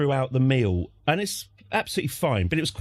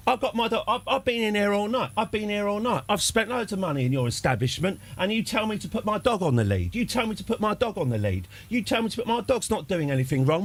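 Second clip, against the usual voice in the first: higher-pitched, relentless mock grievance rant; imitated berating; 6 semitones higher than usual. Dad told to put his dog on a lead, berating the barman. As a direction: pile up complaints like an outraged customer. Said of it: "this sort of berating".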